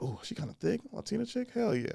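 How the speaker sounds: hushed private hell yeah; quiet inner aside. Retold or imitated. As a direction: say it under your breath to yourself. Inner thought, softer.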